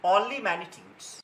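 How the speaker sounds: very slow, spaced teacher dictation with long pauses. Acted. Teacher dictating the scalar definition to students, word by word, very slowly. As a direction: read it out slowly so students can write.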